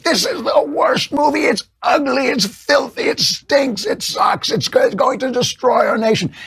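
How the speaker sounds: shrill high mock wail of outrage; hysterical press critic. Host mocks left-wing press with a high hysterical wail. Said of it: "so they're like".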